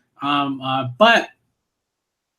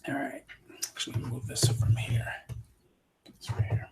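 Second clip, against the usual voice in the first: soft whispered aside; 7 semitones lower, 13 dB quieter than usual. Real, not acted. Adjusting things off mic, a soft whisper. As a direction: whisper it off to the side.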